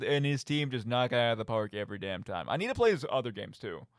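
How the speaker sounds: ordinary talk, nothing marked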